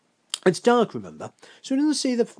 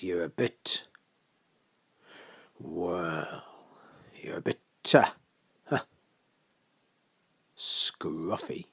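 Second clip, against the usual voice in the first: low, halting, searching for the word; reluctant, pause-filled remark; hesitant tact; 6 semitones lower, 4 dB quieter, slower than usual. Acted. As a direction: hold back, then let the word out softly. Host hesitates over blunt word.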